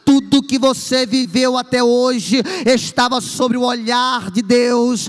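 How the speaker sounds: loud, slow, building preaching; preaching at full force. Acted. Preacher telling the congregation God never lost control, full-force preaching. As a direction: pound each phrase home to the church. Climax of sermon.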